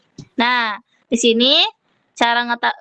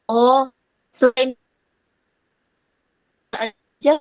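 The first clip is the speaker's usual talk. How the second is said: audio breaking up. Real, not acted. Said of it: "Suaranya putus-putus".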